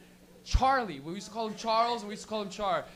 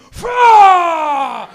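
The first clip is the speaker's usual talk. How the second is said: mock smoke blast; 7 semitones higher, 18 dB louder than usual. Stoner blowing smoke, comic blast.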